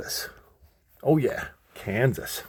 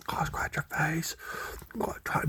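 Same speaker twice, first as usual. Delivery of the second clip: whisper; 4 semitones higher, 11 dB quieter than usual. Real, not acted. Whispering to a puppy.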